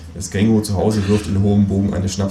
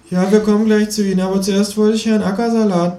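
high, louder piping host voice; 13 semitones higher, 3 dB louder than usual. Acted. Moderator brushing off the kangaroo, high piping voice. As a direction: squeak it out like a flustered host. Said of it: "piept die Moderatorin".

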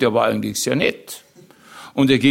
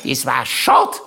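aunt impression rising to a high pitch; Tante Mari; 8 semitones higher than usual. Comedian quoting his aunt's verdict on petrol, high mimicked voice. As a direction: quote the aunt's regret, ending high. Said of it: "weil sie es aber allen".

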